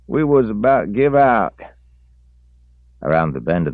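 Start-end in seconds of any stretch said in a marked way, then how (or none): 0.1-1.6 put-on high, loud country old man; twangy old feller; rural old man voice. A narrator's reading. Reese voiced.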